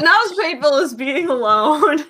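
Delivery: laughing as she repeats it, high; mock his line through a laugh; mocking quote. Repeating the host's jab, laughing. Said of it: "He said".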